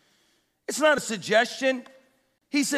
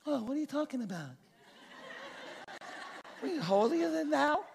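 mock complainer; 5 semitones higher, 9 dB quieter, faster than usual. Mocking a complainer's retort.

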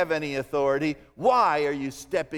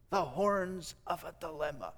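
soft musing; 8 dB quieter than usual. Shown as a demonstration. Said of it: "Isn't that a great idiom".

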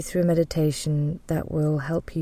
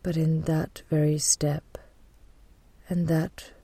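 guided meditation. Real, not acted. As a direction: guide them gently into calm, leaving space to breathe.

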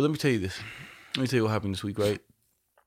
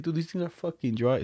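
higher-pitched, disgusted dad; dad complaining; 6 semitones higher than usual. Retold or imitated. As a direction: play the dad griping high. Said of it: "he's like".

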